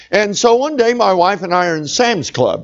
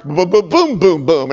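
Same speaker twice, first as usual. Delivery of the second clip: imitated bass thump. Imitating the beat starting again.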